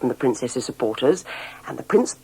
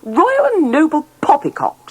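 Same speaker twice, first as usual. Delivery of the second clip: higher, louder scoffing dismissal; scornful; 12 semitones higher, 4 dB louder than usual. A narrator's reading. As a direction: sneer at the whole idea. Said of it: "said the princess".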